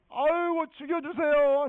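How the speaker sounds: hammed-up wail, high and loud; tantrum plea. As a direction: cry out in exasperated, over-the-top despair. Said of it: "막 승질을 내니까".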